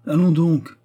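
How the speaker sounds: haussement d'épaules, voix haute et forte; exclamation dédaigneuse. A narrator's reading. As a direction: rejeter l'idée d'une exclamation. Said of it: "s'exclama-t-elle en haussant les épaules". (exclamation dédaigneuse)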